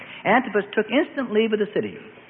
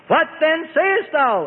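higher, slow, booming demand; bellowed; 8 semitones higher, slower than usual. A narrator's reading. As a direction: bellow the question down at him. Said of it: "Antipas bellowed".

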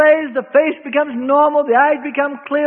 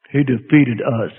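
reluctant demon voice; 11 semitones lower, slower than usual. Retold or imitated. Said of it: "he finally reluctantly said".

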